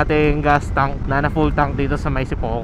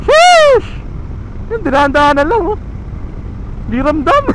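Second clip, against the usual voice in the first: excited shouting; 13 semitones higher than usual. Real, not acted. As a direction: yell with joy over every bump. Thrilled rider over potholes.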